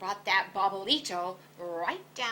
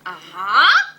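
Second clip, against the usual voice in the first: emphatic agreement; 15 dB louder than usual. A narrator's reading. Poquito Tito confirms.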